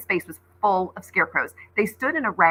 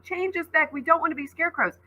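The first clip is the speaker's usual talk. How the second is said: high, hurried begging; pleading scarecrows; 9 semitones higher than usual. Acted. Trapped scarecrow children begging to be changed back, pleading. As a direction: plead in a small high rush.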